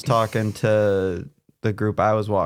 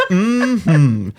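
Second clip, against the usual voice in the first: put-on high, loud old-timey "Mm-hmm" with a laugh; a laughing old-film agreement; 1930s movie character; 8 semitones higher, 5 dB louder than usual. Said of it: "I said it like a nineteen thirties character".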